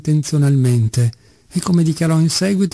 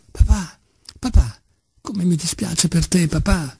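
bitter moan; 4 semitones higher than usual. A narrator's reading. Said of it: "gemeva Ilyusha con amarezza".

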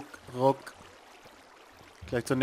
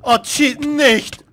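high, loud panicked yell; panicked shout; 9 semitones higher, 10 dB louder, much slower than usual. Real, not acted. Panics as the game goes wrong.

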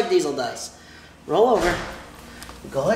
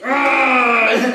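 a playful mock growl; mock monster growl; 2 semitones higher than usual. Showing the dog how to do scary face, mock growl. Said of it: "Diesel, do your scary face".

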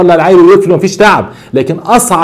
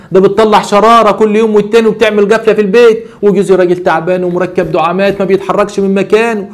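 ordinary delivery

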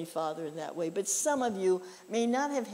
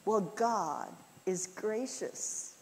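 normal talking voice

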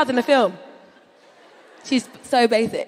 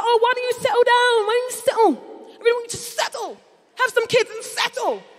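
mum's pleading voice pitched up high; nagging mum; 8 semitones higher than usual. Retold or imitated. Comedian mimicking her mum urging her to settle down. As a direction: plead like a mother begging her daughter to settle. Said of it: "She's like".

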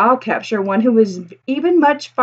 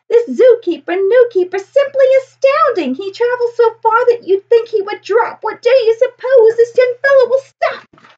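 much higher, wide-eyed crowd voice; amazed townsfolk; 12 semitones higher, 4 dB louder than usual. Acted. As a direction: gush in disbelief, pitched high. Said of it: "people will say".

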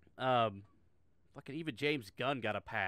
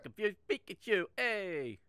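mock meme voice; 7 semitones higher, 3 dB louder, slower than usual. Mocking the clip's meme line.

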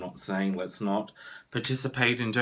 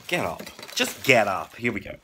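ordinary talk

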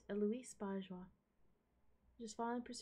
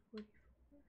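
whispered single word; hushed, sneaking around; soft whispered command to self; 13 dB quieter than usual. Real, not acted.

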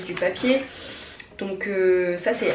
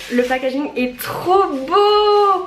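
excited; 8 semitones higher, 5 dB louder, much slower than usual. Real, not acted. Admiring packaging.

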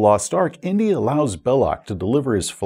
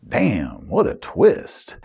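mock amazement; much slower than usual. Players react to villain's twist, mock-amazed. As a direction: play a player floored by the twist.

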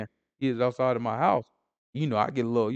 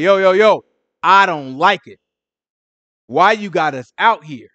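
loud, raised protest; acted-out grievance, pushed loud and higher; complaining; 5 semitones higher, 14 dB louder than usual. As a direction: imitate the people griping to their leader. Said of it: "we could complain to Moses".

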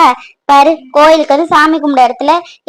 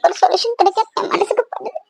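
soft, high tearful murmur; hesitant, halting words between sobs; crying; 4 semitones higher, 4 dB quieter than usual. Acted. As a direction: think aloud through sobs. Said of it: "அழாத, தயவு செஞ்சு அழாத".

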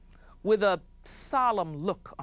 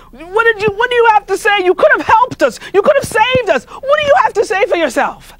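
angry chief yelling; 15 dB louder than usual. Acted. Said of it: "he said to him".

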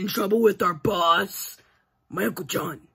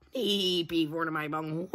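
ordinary delivery

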